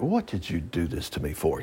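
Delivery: low, soft mock complaint; complaining husband. Husband to wife on the rafting trip, low complaint. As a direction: grumble quietly like a put-upon husband. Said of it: "Her husband was like".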